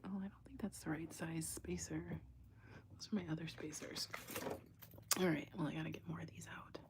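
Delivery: fast whispered aside, last line hurried; whispered to herself. Real, not acted. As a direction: whisper it while sorting beads. Muttering to herself over beads.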